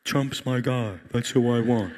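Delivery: low, casual God voice; lightly voiced God. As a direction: voice God shrugging. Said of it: "God, you know".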